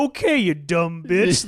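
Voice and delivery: loud, slow mock patronizing; hammed-up dismissive put-down; condescending. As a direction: stretch it out with sneering scorn. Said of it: "even more fucking condescending".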